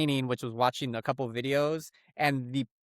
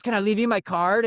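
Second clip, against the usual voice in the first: pushy salesman; 7 semitones higher, 5 dB louder than usual. Retold or imitated. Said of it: "he goes".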